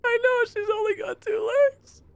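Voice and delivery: chirpy surprised underwater stranger; squeaky NPC. Acted. Trapped islander greets the first visitor, high squeaky.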